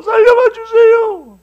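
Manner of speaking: loud, very high, drawn-out wail; hammed-up crying apology; tongue-tied begging. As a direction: imitate a terrified robber pleading. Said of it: "말도 안 나오고".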